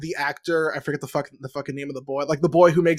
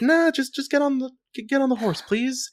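a lightly voiced, higher, softer coaxing plea; imitating Annabeth; 7 semitones higher, 4 dB quieter than usual. Annabeth to Nico, a lightly imitated higher soft coaxing. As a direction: coax him gently onto the horse. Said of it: "And Annabeth is like".

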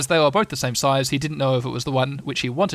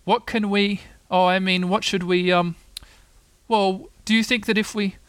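higher, halting, fumbling for words; distressed; 6 semitones higher than usual. A narrator's reading. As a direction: stammer helplessly as a worried Pooh. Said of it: "said Pooh in distress".

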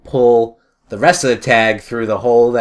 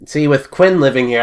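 ordinary talk, nothing marked

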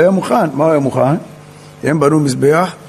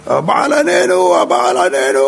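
imitated crying out; 9 semitones higher than usual. Baal's prophets calling to their god, mock cry. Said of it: "התחילו לצעוק".

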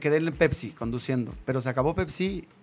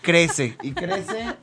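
laughing; 2 semitones higher, 4 dB louder, much slower than usual. Real, not acted. Laughs at the tribe joke.

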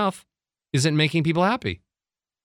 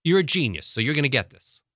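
usual speaking voice

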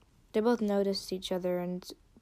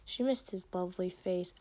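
usual speaking voice

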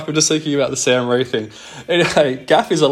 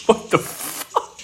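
high, slow, breathy what the fuck; baffled disbelief; 10 semitones higher, 3 dB quieter, slower than usual. Real, not acted. Reacting to the commissioner's nonsense message, stunned disbelief.

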